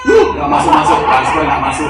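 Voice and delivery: high, loud hammed-up fuss at the phone; mock angry complaint. Speaker imitates someone angry at a failed bank transfer, loud mock fuss. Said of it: "Marah-marah sendiri ya".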